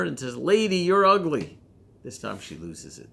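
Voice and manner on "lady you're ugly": loud, higher squawking parrot call. Acted. Parrot insulting the woman on the third day, loud. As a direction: shout it like a cheeky parrot.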